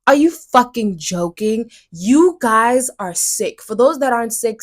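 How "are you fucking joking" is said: ordinary talk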